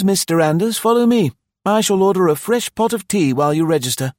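high, loud, cheerful innkeeper; eager host. A narrator's reading. Host assures arriving guest there is a vacancy, eagerly.

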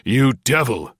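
loud furious accusation; angry cry. A narrator's reading. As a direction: spit the insult at him. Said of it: "he cried out".

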